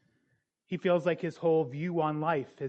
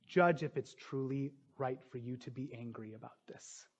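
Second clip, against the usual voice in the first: softer, lower reading of God's words; gentle paraphrase; 3 semitones lower, 6 dB quieter than usual. Shown as a demonstration. Preacher re-reading God's question to Jonah gently. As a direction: read it as a gentle invitation. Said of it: "Try to understand what I am doing here".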